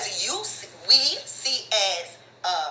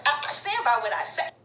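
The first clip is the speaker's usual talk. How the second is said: normal talking voice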